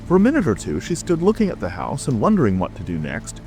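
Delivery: plain reading voice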